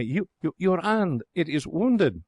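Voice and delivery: high, halting cry of dismay. A narrator's reading. Lady sees his bitten hand and cries out in dismay. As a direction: gasp it out in alarm. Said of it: "She has given a cry of dismay".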